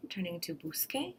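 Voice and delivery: busque said with a hard K, bus-KAY; busque with a K sound. Shown as a demonstration. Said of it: "just to keep that K sound".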